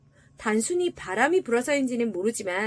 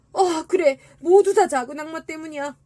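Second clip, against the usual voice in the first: raised, loud voice of sudden realisation; tin soldier realising; 4 semitones higher, 6 dB louder than usual. A narrator's reading. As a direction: voice the soldier blaming the devil. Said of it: "양철 군인은 생각했습니다". (높고 큰 소리로 깨닫는 양철 군인)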